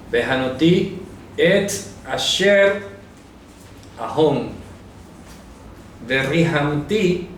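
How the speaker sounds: slow, careful "Behanoti et asher ahom, berrihamti et asher arrahem"; slow Hebrew dictation. Shown as a demonstration. Teacher to Bible class, dictates the Hebrew verse so they can write it down. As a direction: dictate each Hebrew word slowly, with pauses between them. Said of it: "Vamos a escribir la pronunciación".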